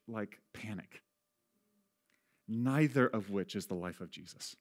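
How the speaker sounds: ordinary delivery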